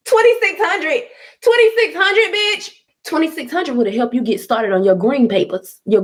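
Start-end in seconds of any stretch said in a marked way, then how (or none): 0.1-2.7 high, laughing scorn at the number; laughing mockery. Real, not acted. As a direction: cackle the amount back in disbelief. Laughs at the price demanded.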